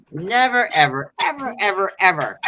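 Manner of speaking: loud, insistent never ever ever; emphatic never. Real, not acted. Swearing she would never take them back, loud and emphatic. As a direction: hammer every ever home.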